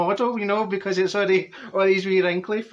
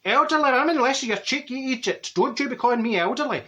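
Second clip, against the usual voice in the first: indignant retort; 8 semitones higher than usual. Retold or imitated. Offended at the boys calling her elderly, indignant. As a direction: bristle at being called old. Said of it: "And I went".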